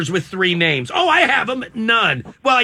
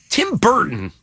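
ordinary talk, nothing marked

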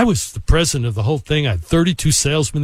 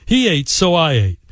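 plain reading voice

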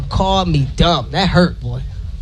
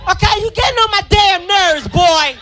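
furious mom shouting; 11 semitones higher than usual. Retold or imitated. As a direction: lose it at the boy. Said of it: "She's like".

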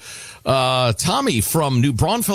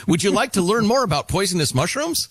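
helpful chatbot offer, pitched up; acted AI assistant; 8 semitones higher than usual. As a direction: offer help obliviously in a higher voice. AI chirps at man's grave.

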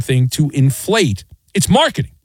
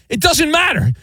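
shouting; 11 semitones higher than usual. Real, not acted. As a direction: shout it at the team. Host ranting at team.

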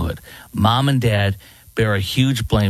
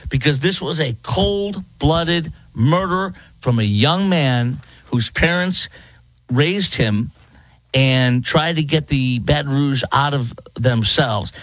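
ordinary talk

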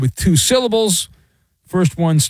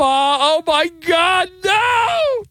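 hammed-up shriek; much slower than usual. Radio host mocking outraged fans with a fake scream. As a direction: shriek like a scandalized viewer.

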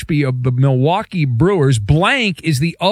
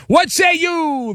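playful call-out; 11 semitones higher, slower than usual. Acted. Host calls on co-host.

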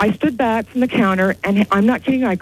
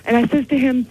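ordinary talk, nothing marked

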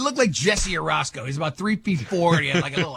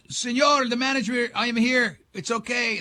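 higher, soothing call through a door; calm the guests down, a bit higher; lightly voiced hotel manager; 6 semitones higher than usual.

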